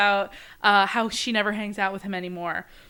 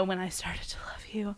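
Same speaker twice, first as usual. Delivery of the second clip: soft unvoiced confession; whispered; 11 dB quieter than usual. A narrator's reading. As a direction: whisper it as a secret. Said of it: "And she says".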